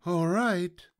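high, falling piglet voice; sighing piglet. A narrator's reading. Piglet told to stay still, sighing high. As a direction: agree with a high-pitched sigh. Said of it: "sighed the piglet".

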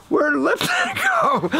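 high, puzzled "Where did Lipton go?"; high mock bewilderment; puzzled audience call. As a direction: play the baffled crowd with a laugh.

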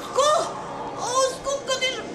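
high desperate calls for help, last one drawn out slowly; crying for help. Acted. Boy thrown overboard cries for help, high and panicked. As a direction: yell for help as the water closes in.

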